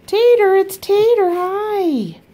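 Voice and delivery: high, loud, excited call to a pet; pet greeting. Real, not acted. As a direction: greet the dog with delight. Greeting the dog, Tater.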